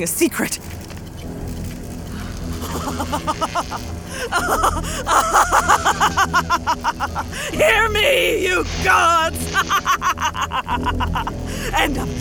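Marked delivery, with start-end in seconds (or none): 0.6-7.5 building high-pitched mad cackle; unhinged scientist's long cackle; maniacal laughter. Acted. Mad scientist's triumph in the storm. 7.5-9.5 high, loud cry to the heavens; crazed, triumphant yelling; manic defiant shout. Acted. Mad scientist challenges the gods. 9.6-11.7 high-pitched rapid mad laugh; crazed, ringing laughter; maniacal cackle. Acted.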